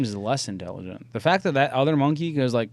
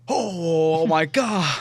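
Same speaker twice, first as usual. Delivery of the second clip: higher, slow, drawn-out astonishment; mock amazement; 5 semitones higher, 4 dB louder, much slower than usual. Imitating a monkey after the trick, amazed. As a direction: gasp it out as if stunned.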